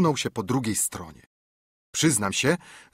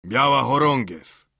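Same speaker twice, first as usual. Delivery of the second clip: louder call across the yard; 3 semitones higher, 6 dB louder than usual. A narrator's reading. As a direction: hail them from outside the fort. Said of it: "zawołał Silver". (głośne wołanie z daleka)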